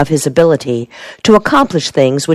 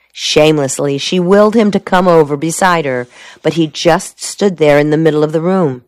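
plain reading voice

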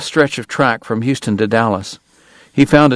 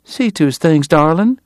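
higher-pitched wifely command; sweetly ordering; 7 semitones higher than usual. A narrator's reading. Missus Segar to her husband, sweetly ordering. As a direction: send the husband off in a high, ladylike voice. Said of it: "she ordered".

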